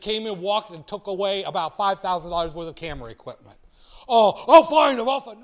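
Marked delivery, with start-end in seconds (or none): 4.1-5.4 indignant friend. Retold or imitated. Friend insists on chasing thief.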